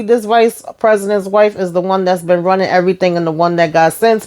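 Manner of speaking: ordinary talk, nothing marked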